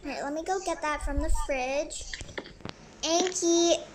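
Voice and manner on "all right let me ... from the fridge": normal talking voice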